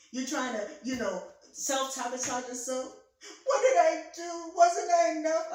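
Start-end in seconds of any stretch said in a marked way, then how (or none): 3.5-5.5 high and loud, hammed-up anguish; theatrical despair. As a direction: overplay the jilted lover's cry. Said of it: "sometimes I'm theatrical".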